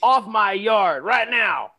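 angry neighbor. Acted.

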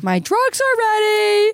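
high, slow put-on sing-out; mock singsong announcement. Announces pharmacy call in put-on voice.

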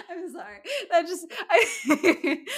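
high-pitched, giggling apology; laughing. Real, not acted. Host apologizing after cracking up at accent, laughing. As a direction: struggle to speak through laughter.

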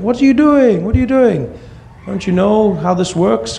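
high-pitched, exasperated protest; exasperated kid. Retold or imitated. Son silently urging mom to stop, exasperated.

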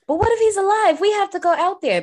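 high, loud mock pleading; exaggerated high-voiced protest; Kenya. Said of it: "Kenya, she was like".

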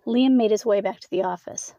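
ordinary delivery

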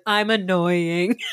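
loud, drawn-out mock announcement; exaggerated self-introduction; imitating drunk castmate. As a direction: declare it slowly and loudly, hammed up.

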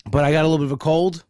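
usual speaking voice

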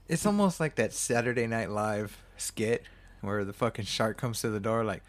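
usual speaking voice

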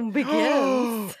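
"Begins" hammed up high; dramatic echo. Host echoing the story's last word, mock-dramatic. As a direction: announce "Begins" like a big dramatic reveal.

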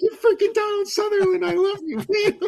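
shrieking high and loud, starstruck; starstruck excitement. Retold or imitated. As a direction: gush at a movie star you can't believe you're meeting. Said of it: "I'm freaking out".

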